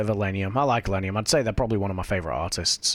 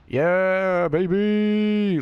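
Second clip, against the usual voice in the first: excited cheer; 8 semitones higher, 3 dB louder, much slower than usual. Real, not acted. Cheering after dodging rock.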